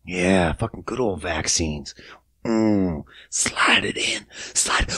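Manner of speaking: low, soft dirty talk sinking to a whisper; hammed-up lewd whisper, slow; mock seduction. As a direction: whisper it like fake pillow talk. Mock sex scene about vaccines.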